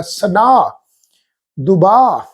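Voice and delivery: ਸਨਾ and ਦੁਬਾ with ਹ blended into long aa; merged h. Shown as a demonstration. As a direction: stretch the aa, no separate h. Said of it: "ਉਹਦੇ ਵਿੱਚ ਮਿਲ ਕੇ pronounce ਹੋਏਗਾ".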